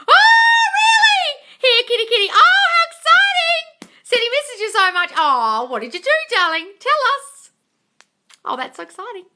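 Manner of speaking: very high, loud excited squealing; gushing high-pitched excitement; thrilled squeals. Real, not acted. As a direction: squeal with delight at the news. Viewer says her SP returned.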